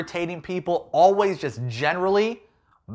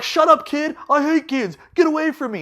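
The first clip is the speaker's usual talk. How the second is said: high, louder panicky snap; snapping at the kid; 12 semitones higher, 5 dB louder than usual. Retold or imitated. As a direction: shove the kid off verbally. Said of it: "And Peter's like".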